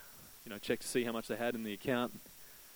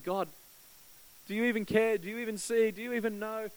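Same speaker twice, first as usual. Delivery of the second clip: pleading question; 8 semitones higher than usual. Retold or imitated.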